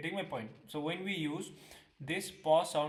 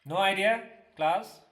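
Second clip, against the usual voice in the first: louder, raised to reach the room; calling to class; 4 semitones higher, 9 dB louder than usual. Real, not acted. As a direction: call out to the whole class. Teacher calls out to class.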